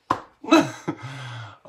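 short giggle; brief amused laugh. Real, not acted. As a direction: giggle at your own flirty joke. Laughs after own flirty joke.

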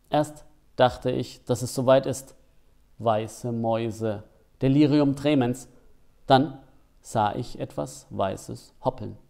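ordinary delivery

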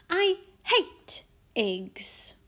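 put-on high, slow, emphatic child's refusal; disgusted little princess. A narrator's reading. Princess refusing her breakfast eggs, disgusted. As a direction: drag out each word in protest.

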